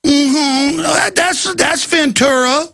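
high, put-on leering voice; mock leering screener. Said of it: "We're going".

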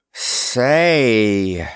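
drawn-out, tentative opener; cautious. A narrator's reading. Man sounding out the princess, cautious. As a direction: stretch the word warily. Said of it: "he asked cautiously".

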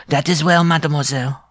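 high, forceful dwarf interruption; breaking in. A narrator's reading. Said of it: "the dwarf broke in".

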